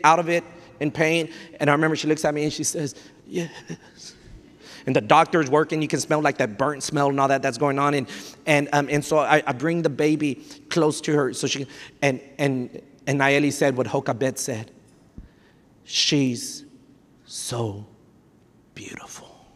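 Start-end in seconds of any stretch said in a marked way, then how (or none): 3.3-4.1 breathless whispered yes from a mother in pain; weak whisper. Retold or imitated. Exhausted wife answering in pain. 15.8-19.5 fading into a hushed whisper; awed whisper. Retold or imitated. Mother first seeing her baby.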